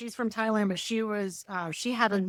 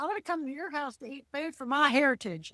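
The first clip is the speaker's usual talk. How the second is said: put-on heavy Southern accent; 8 semitones higher than usual. Grandson announcing himself to Mimi, imitated Southern accent. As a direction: mimic a country boy's twang, pitched up. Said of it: "he has his heavy accent".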